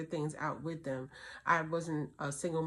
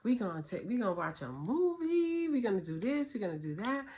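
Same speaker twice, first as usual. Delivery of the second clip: normal talking voice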